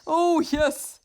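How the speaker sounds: high, worried Toad voice, climbing at the end; Toad's voice. A narrator's reading. Toad frets over his frightened seeds, high and worried.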